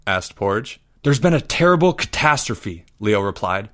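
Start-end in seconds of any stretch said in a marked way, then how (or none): none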